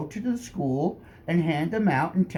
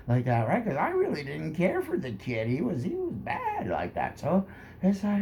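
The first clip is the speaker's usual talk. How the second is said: normal talking voice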